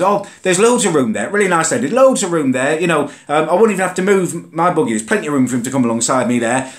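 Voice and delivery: a kind woman cheerfully offering room; mock sweet lady voice. Comes over to him and says.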